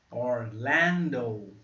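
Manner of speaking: Orlando very slow, stressed on lan. Shown as a demonstration. Teacher breaking down Orlando, very slow. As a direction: draw out Ó, Orlando syllable by syllable. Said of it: "A sílaba forte está aqui, ó, no L".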